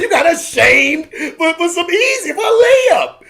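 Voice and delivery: high, loud, laughing protest; high-pitched laughing shout; laughing outburst. Real, not acted. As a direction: cry it out through laughter, stretching the words. Laughing outburst at the missed chance.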